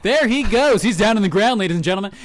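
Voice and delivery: high, excited play-by-play; mock commentary. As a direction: call it like a live radio stunt. Mock live commentary on air.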